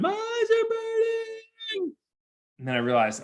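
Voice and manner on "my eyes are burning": put-on very high, drawn-out child's shriek; mock screaming. Son with pepper in his eyes, mock screaming. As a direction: wail it slowly like a kid in pain. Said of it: "I hear him screaming at the curb".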